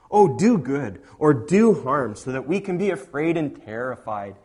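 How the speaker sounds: loud, swooping sarcastic taunt; sarcastic mocking. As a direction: taunt the idols with scornful mock pleading. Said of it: "God is sarcastically mocking idols".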